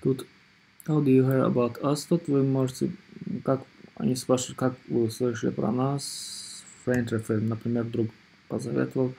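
ordinary delivery

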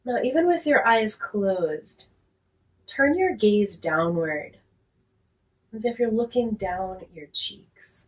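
guided relaxation. Real, not acted. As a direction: talk them gently down into rest, unhurried and quiet.

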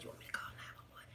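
very soft fast whisper; quietly offering to call nine one one, whispered. Retold or imitated. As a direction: breathe it out with no voice. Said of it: "I whispered so low".

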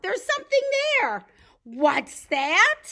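high, loud, startled questioning; hammed-up fright for children; alarmed. Eddie sees something in the dark, alarmed.

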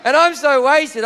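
ordinary talk, nothing marked

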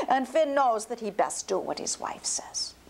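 usual speaking voice

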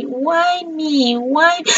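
high, drawn-out whine; dismayed wail. Real, not acted. Streamer lamenting her rosemary, high self-pitying whine.

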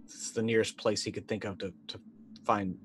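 usual speaking voice

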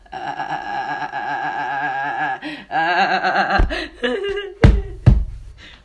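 long, loud crazed laugh; maniacal laughter. Acted. Clown bursting into loud crazed laughter. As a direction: cackle like a madman.

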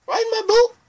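very high, loud lilting offer; high cheery boat invitation; singsong ferryman. Acted. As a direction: sing it out like a whimsical boatman. River Person asks again.

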